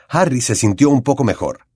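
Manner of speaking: ordinary delivery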